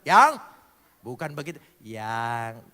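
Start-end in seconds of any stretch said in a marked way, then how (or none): 0.1-0.4 "Yang" barked short and loud with a rise; a loud, sharp "Yang" the wrong way; curt loud call. Shown as a demonstration. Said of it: "jadi sayangnya itu jangan". 1.8-2.6 "Yang" said softly and lower, full of affection; a soft, tender "Yang" the right way; loving tone. Shown as a demonstration. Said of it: "Intonasinya itu penuh nada kasih".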